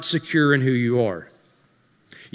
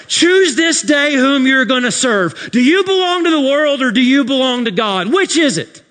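loud, high preaching climax; full-force preaching; 11 semitones higher, 7 dB louder than usual. Acted. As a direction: thunder it to the congregation.

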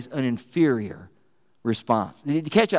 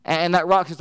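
ordinary delivery

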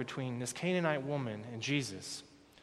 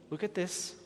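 normal talking voice

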